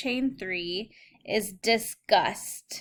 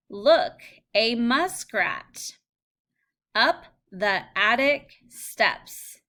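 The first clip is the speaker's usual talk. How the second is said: slow, deliberate read-aloud of each phrase; 3 dB louder, slower than usual. Shown as a demonstration. Teacher reads practice phrases to students, slow and careful. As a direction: model each phrase slowly for the class. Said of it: "reading practice phrases aloud to students".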